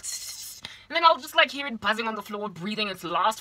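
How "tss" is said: imitated aerosol hiss; spray can hiss. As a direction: hiss like bug spray at a fly. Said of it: "grab some doom and be like".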